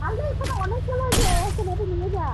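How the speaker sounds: loud, high warning call; alarmed shout. Real, not acted. Alarmed at many enemies.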